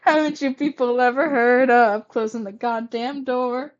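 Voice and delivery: exasperated acted outburst; loud, indignant character complaint; loud scolding. A narrator's reading. Character scolds others in acted scene.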